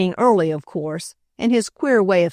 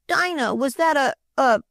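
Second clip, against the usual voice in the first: put-on mildly scared little boy, higher, stumbling on a; frightened stammer; 8 semitones higher than usual. A narrator's reading. Boy asking the maid about the toad, mildly frightened. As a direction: stammer the question, a touch afraid.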